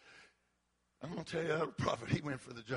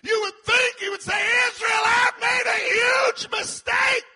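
full-throated high preacher's cry; preaching shout; 13 dB louder than usual. Acted.

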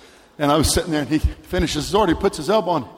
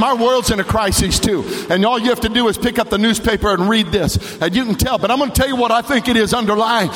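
raised, insistent pulpit voice; driving preaching; 7 semitones higher, 4 dB louder than usual. Real, not acted. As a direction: press the point home in a preacher's push. Preacher presses on with his point.

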